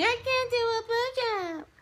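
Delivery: put-on high, slow whiny baby voice; hammed-up baby-voiced whine; mock baby talk. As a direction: mimic a whiny baby begging off. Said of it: "What's with the baby talk?".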